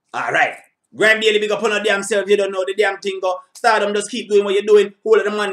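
loud, high, pumped-up shout-outs; big him up with full energy; hyped shout-outs. Real, not acted. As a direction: hype man calling out names. Hyped shout-outs after the track.

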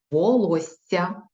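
волосся said slowly with a rise; slow checking read. Shown as a demonstration. Teacher checking dictation, slow. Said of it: "Перевіряємо".

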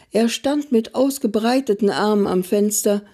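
plain reading voice

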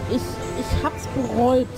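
ordinary talk

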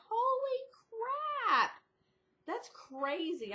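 high, squealing mock shock that slows down; amazed outburst. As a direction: gasp it out like you can't believe the number. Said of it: "I was like".

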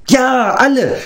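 eager creature yelling agreement; Gogi cries out. A narrator's reading. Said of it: "schrie Gogi".

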